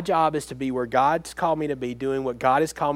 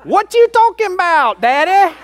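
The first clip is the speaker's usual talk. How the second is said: put-on high, loud squeaky little-girl voice; toddler talking back; 11 dB louder than usual. Preacher voicing his defiant toddler daughter, high and loud. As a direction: play the sassy two-year-old pushing back hard. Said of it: "she went".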